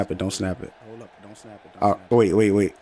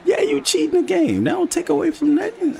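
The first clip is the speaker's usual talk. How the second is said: mocking high voice. Mock agreeing with cheating accusation.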